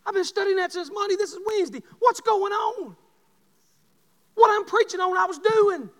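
high, loud preacher's cry; preaching at full force. Acted. Pastor confessing to the congregation, full-force preaching. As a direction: hammer it home to the church.

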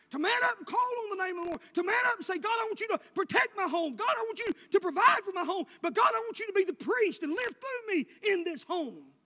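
shouted, high preacher's cry; full-force preaching. Acted. Preacher commanding fathers to pray for their homes, shouting. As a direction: shout the call to man up and pray.

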